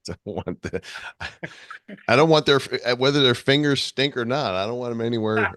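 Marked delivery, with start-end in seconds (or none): 2.1-5.5 raised, emphatic protest; loud emphatic. Real, not acted. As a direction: push it out loud. Joking outburst, loud.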